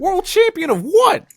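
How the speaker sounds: high, loud, slow challenge in an impersonated voice; Noah Lyles. Acted. Host needling a champion's defender, mock-incredulous impersonation. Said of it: "that was my Noah Lyles impersonation".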